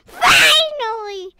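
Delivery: triumphant shout; excited shout. Real, not acted. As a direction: yell it with relief. Relief at reaching goal.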